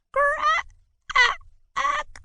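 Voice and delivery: very high strained croak. A narrator's reading. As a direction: croak it weakly after coughing. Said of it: "she croaked".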